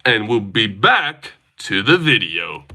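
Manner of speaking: slow YouTuber sign-off with dramatic pauses; classic YouTuber voice. Acted. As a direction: draw it out like a stock YouTuber. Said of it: "he's just got that classic YouTuber voice".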